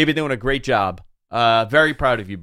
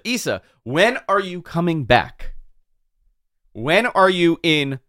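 ordinary delivery

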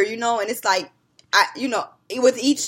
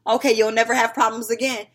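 stern mom demand; 4 semitones higher, 7 dB louder than usual. Retold or imitated. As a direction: grill the kids like a strict mom. Mom confronting kids off bus.

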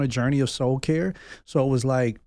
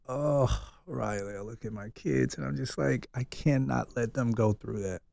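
soft, tender; 7 dB quieter than usual. Real, not acted. Tender thought of his kids.

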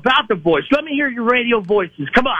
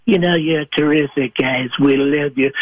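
lower, boastful Trump voice; Donald Trump impression; 3 semitones lower than usual. A caller playing Donald Trump for the hosts, boastful and lower. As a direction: brag like Trump on a rally stage. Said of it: "It's Donald Trump".

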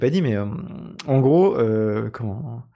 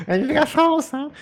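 mock patriotic; 12 semitones higher than usual. Echoing mock patriotic cheer.

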